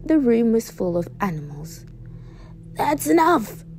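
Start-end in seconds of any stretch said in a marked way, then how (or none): 2.8-3.6 high, loud teacher's reprimand; sternly raised storybook voice; stern shout. A narrator's reading. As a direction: shout the class to order. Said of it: "shouted Miss Brewer sternly".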